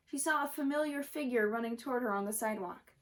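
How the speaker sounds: ordinary delivery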